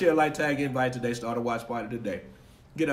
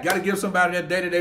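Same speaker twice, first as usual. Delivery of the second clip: ordinary talk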